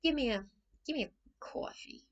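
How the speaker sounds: high-pitched imitation of brusque American mum; blunt, rude demand. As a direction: order bluntly with a Brooklyn twang. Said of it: "might be seen as rude".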